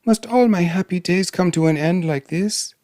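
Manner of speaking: high-pitched plea of a princess; despairing cry. Acted. Princess hears the lions coming, despairing. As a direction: lift the voice in helpless alarm. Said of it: "she cried".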